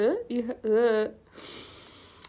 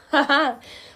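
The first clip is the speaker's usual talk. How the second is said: sudden, louder laugh; cracking up; 4 semitones higher, 6 dB louder than usual. Real, not acted. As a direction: burst out laughing mid-sentence. Cracks up mid-reading.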